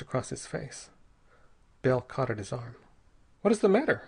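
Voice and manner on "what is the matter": high, loud anxious question; anxious demand. A narrator's reading. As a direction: demand it with worry. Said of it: "he demanded anxiously".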